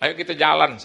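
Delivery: loud, urging everyone forward; rallying call. Retold or imitated. Pastor rallying grieving family, loud rallying call. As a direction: rouse them to move.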